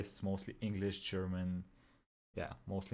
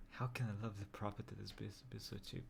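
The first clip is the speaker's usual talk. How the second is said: hushed, creepy killer voice; slasher killer; 13 dB quieter than usual. Retold or imitated. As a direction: read it like a quiet murderer. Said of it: "legit sounds like a serial killer".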